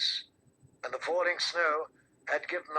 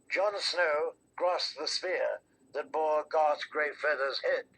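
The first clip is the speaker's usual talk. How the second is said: ordinary delivery, nothing marked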